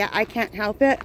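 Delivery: ordinary delivery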